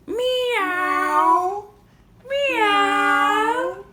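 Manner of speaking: high, loud, drawn-out meows; cat meow. Pretend. Meowing as a cat.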